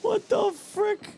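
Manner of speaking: high, slow incredulous cry; disbelief. Real, not acted. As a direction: drag out the disbelief. After dying.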